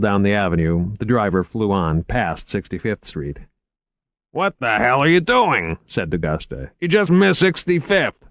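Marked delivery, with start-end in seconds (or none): 4.3-5.8 higher, indignant challenge; exasperated outburst. Acted. D'Augusta reacting to the missed turn, exasperated outburst. 6.8-8.2 much higher, louder protest; exasperated complaint. Acted. D'Augusta telling driver he missed the street, exasperated complaint.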